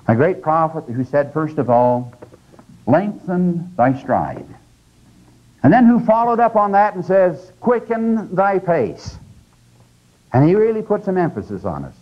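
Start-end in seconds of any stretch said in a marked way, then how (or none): 2.8-4.4 slow, weighty quotation of a prophet; solemn quote. Acted. Quoting prophet slowly. 7.6-9.2 slow, weighty quotation of a prophet; solemn quote. Acted. Quoting prophet slowly.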